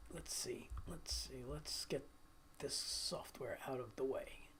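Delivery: breathy whisper under his breath, half to himself; soft whispered muttering while setting up; whispering to self. Real, not acted. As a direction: mutter it to yourself while fiddling with the computer.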